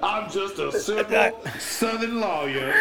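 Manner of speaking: a folksy Southern lawyer voice; Southern lawyer drawl. Retold or imitated. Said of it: "sounding like a Southern lawyer".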